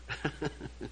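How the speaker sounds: soft, short chuckle; real quiet laugh to oneself. Real, not acted. Laughs after his own remark.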